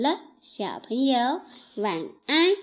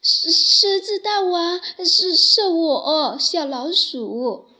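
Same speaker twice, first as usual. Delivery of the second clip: higher, louder, stuttering and slow with fear; trembling, stammering; 5 semitones higher, 6 dB louder than usual. Acted. Frightened little mouse stammers before the lion king. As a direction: quake out each halting word. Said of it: "哆嗦着说道".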